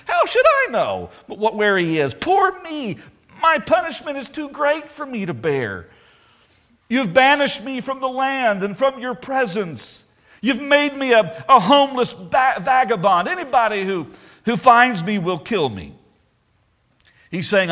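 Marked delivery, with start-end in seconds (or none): 0.1-2.1 high, pleading whine, stumbling on the words; whining Cain. Retold or imitated. Cain whining to God, caught out: high self-pitying whine. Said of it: "you can almost hear the whine in Cain's voice". 2.1-15.9 high, self-pitying whine that stays high; whining Cain. Retold or imitated. Cain complaining to God about his curse, high whine. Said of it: "you can almost hear the whine in Cain's voice".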